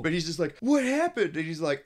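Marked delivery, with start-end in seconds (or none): 0.6-1.4 high-pitched, louder shocked cry of what happened. Retold or imitated. Hermit asking the turkey about his dog, shocked and high. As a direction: yelp it out in alarm.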